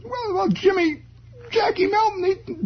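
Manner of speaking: put-on high stammering boy's voice; flustered kid. Boy stumbling through an answer to his father, high. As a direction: stumble over names, high.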